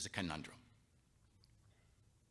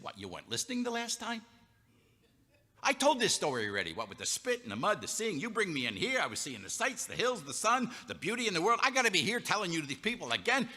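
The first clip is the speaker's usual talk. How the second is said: higher, louder, fed-up retelling, faster at the end; prickly and exasperated; 9 semitones higher, 4 dB louder than usual. Acted. Healed man snapping at the Pharisees for asking twice, exasperated. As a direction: snap at them for asking again. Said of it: "He's a little prickly".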